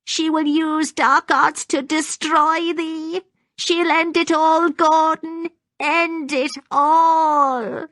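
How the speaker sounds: high-pitched, wailing prophecy of doom; frantic old woman. A narrator's reading. Maggie warns Gordon of dark arts, frantic high wail. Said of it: "She spoke rapidly, the words tumbling out".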